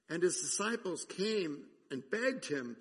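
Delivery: ordinary talk, nothing marked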